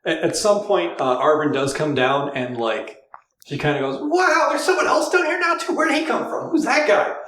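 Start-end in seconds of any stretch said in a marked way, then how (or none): 4.0-7.2 high, loud surprised call; loud high-pitched exclamation; loud excited shout. Acted. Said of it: "her being so loud".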